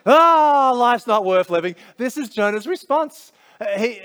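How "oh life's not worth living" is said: a higher, louder, overblown wail of self-pity; melodramatic kid. Retold or imitated. Child who didn't get what he wanted, melodramatic wail. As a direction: ham up a sulking child's tragedy. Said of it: "how dramatic they can be".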